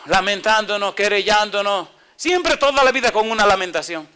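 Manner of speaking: high, loud fiery rebuke; preaching at full force. Acted. Preacher chiding complaining Christians, high and loud. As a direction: scold the flock from the pulpit.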